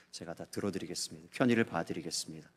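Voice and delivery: humbly smooth it over with the client; gently deferential sorry; polite apology. Retold or imitated. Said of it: "그러면서 정중하게 해서".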